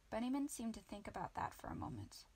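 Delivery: usual speaking voice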